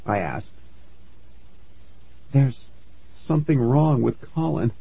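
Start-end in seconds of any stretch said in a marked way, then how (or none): 2.3-4.7 worried mom. A narrator's reading. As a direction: voice a troubled mother, holding back. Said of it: "Mom said, biting her bottom lip".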